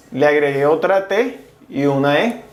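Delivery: usual speaking voice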